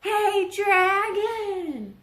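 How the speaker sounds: very high, loud, slow drawn-out yell; shouting as loud as possible. Acted. Princess yelling into the sleeping dragon's ear, full volume. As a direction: bellow it right into his ear. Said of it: "She shouted as loud as she could".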